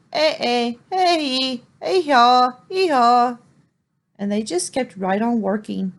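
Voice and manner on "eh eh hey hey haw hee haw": loud braying hee-haw; donkey bray. Acted. Donkey's call heard by the crowd as a loud bray. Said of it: "it only sounded like he was saying".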